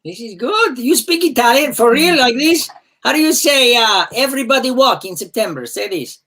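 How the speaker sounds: high-pitched, hammed-up Italian accent; mock Italian accent. Host testing a coworker who speaks Italian, in a mock Italian accent. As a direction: put on a playful fake Italian accent. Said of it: "I just do the accent like this".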